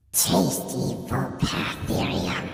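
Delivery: slow, demonic hiss of a monster. Acted. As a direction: savor it like a demon. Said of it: "said in a demonic voice".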